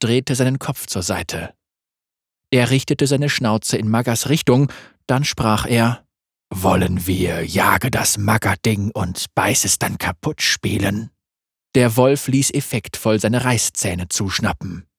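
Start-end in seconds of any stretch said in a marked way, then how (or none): 6.5-11.1 langsam, tief und lauernd; tiefe Wolfsstimme. Acted. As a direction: ein Raubtier, das leise droht. Wolf voice again. (Wolf spricht tief und langsam)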